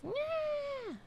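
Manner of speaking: high squeaky cat yowl; mock cat cry. Imitating the cat, high squeaky yowl. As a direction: screech like the annoyed cat.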